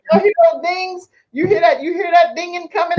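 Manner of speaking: high-pitched, playful exclaiming; mock excitement. Host jokes about donation dings coming in, mock excited.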